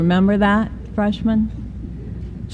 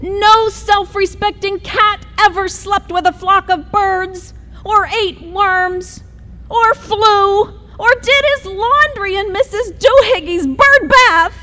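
high, scolding mom voice; mother lecturing her kitten, high and scolding; 12 semitones higher than usual. Acted. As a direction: lay down the law like a nagging mother. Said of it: "The lecture went on and on".

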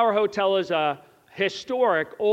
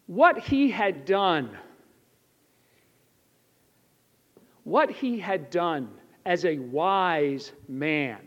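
slow sincere emphasis; 4 semitones lower, slower than usual. Real, not acted. Preacher slows down for the lesson.

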